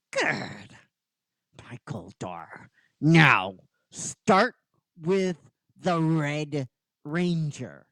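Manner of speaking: slow, deliberate villain order; a light villain voice, slowly savouring the command; Rita. Acted. Villain orders Goldar onto the Red Ranger, slow and deliberate. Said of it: "and Rita goes".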